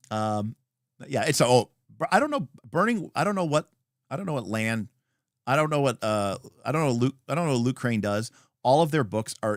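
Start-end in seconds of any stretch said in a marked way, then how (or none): none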